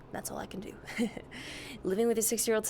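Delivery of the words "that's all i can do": whispered aside. Real, not acted. Trailing confession.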